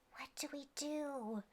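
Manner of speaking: a soft unvoiced whisper; a hushed uncertain question; whispered worry. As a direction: whisper it, unsure. Pirates unsure what to do.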